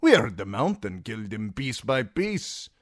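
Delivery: Dornish sellsword telling it casually; Timion recounting. A narrator's reading. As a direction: Timion telling Brienne the gang's fate. Timion voiced at length.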